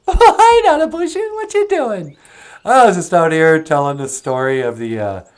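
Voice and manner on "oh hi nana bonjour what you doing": very high, giggly puppet voice; high puppet voice greeting Nana; [name]'s puppet voice. Acted. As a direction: pitch way up and chirp like the puppet.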